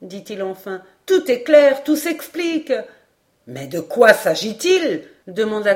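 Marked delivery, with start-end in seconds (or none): 1.0-2.7 high, loud burst; elated high-pitched deduction; excited realization. A narrator's reading. Paganel's sudden realization. 3.5-5.0 loud, pressing question; impatient query with raised voice; worried, impatient. A narrator's reading. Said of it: "aussi inquiet qu'impatienté".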